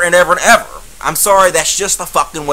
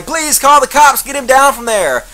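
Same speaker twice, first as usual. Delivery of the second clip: mock frightened plea; 6 semitones higher than usual. Horrified crowd member pleading.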